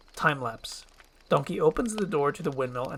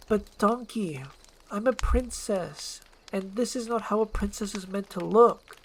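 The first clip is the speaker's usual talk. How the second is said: lamenting princess; 7 semitones higher than usual. Acted. Princess laments her looks.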